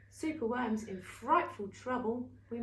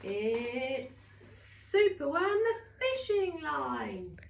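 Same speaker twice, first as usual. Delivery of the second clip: drawn out, very slow, louder; slow triumphant reveal; 2 semitones higher, 4 dB louder, much slower than usual. Acted. Storyteller slowly revealing Superworm's rescue of Beetle. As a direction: stretch the big reveal.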